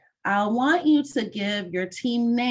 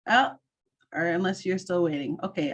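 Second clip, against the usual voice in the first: ordinary talk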